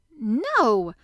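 loud, indignant denial; shouted. A narrator's reading. Boy denies he has a crooked back, shouted. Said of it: "shouted Colin".

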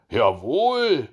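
loud, swooping hearty yes; acted hedgehog, loud and ready; emphatic assent. A narrator's reading. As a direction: answer up with gusto. Hedgehog confirms the start.

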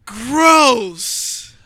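loud, drawn-out revulsion; loudly grossed out; real disgust. Real, not acted. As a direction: recoil out loud, high and loud. Disgust at the service voices.

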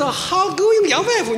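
the asker slowly repeating "How going, your wife？"; patient slowed-down repeat. Retold or imitated. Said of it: "他说，".

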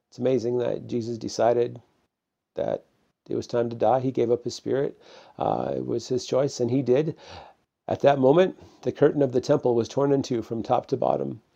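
usual speaking voice

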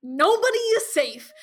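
high, loud, slow proclamation; dramatic alarm. Real, not acted. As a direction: declare it like a dire warning. Dramatic recap of shocking twist.

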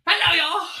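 high, loud, hearty hello; cheery greeting. Acted. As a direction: burst in cheerfully on the crew. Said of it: "Jebediah is like".

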